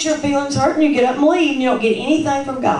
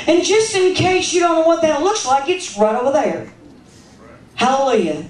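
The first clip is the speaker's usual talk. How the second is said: ordinary delivery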